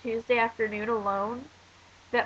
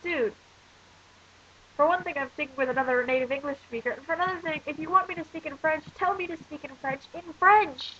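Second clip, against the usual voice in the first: higher and higher, fed-up ranting; exasperated rant; 5 semitones higher than usual. Real, not acted. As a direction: vent the frustration, building up. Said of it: "turn into a rant".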